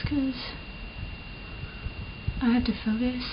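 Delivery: normal talking voice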